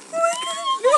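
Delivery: very high, tearful squeak; terrified squeal. Real, not acted. Finding the bug still alive, terrified squeal. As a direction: squeak it out in horror.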